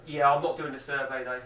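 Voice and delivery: low, quiet, distant off-mic reply; away at the door. Real, not acted. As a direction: turn away and answer a doorstep caller. Answers caller at the door.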